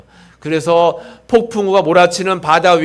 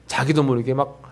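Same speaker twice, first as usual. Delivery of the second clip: normal talking voice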